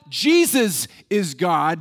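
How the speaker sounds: normal talking voice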